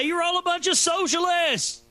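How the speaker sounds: high, slow, yelled as if through a bullhorn; bullhorn shout. Pretend. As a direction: blast it at the protesters like a megaphone. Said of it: "I would go bullhorn them".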